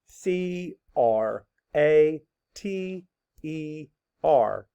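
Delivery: letters spelled out slowly; slow spelling. Shown as a demonstration. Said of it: "Spell it".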